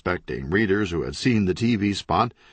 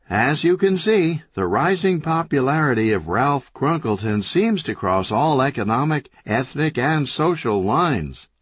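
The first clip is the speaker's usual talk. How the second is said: on-air reporter; 6 semitones higher, 3 dB louder than usual. Acted.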